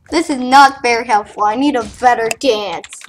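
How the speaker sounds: normal talking voice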